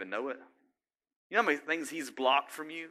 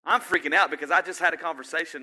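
loud, raised-pitch mock worry; acted freaking out; 5 semitones higher, 6 dB louder, faster than usual. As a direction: voice a worried person, louder and higher.

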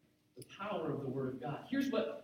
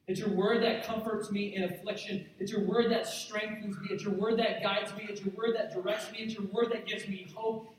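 insistent litany, raised in pitch; impassioned preaching; 4 semitones higher, 4 dB louder than usual. Acted. Preacher voicing David's devotion to the congregation, impassioned and insistent. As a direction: drive each repeated phrase home, building with conviction.

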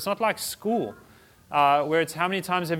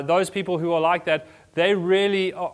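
ordinary delivery

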